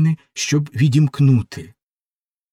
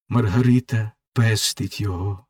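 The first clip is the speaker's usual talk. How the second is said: usual speaking voice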